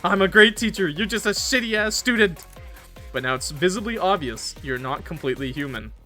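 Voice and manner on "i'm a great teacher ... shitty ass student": loud, higher snapping comeback; angry retort. Acted. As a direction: snap back at a useless student. Teacher snaps back at robber.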